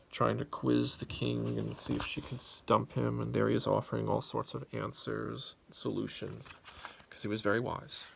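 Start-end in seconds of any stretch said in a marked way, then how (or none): none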